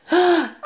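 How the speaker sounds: high startled gasp; sharp gasp. A narrator's reading. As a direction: gasp in sudden shock. Broom snaps.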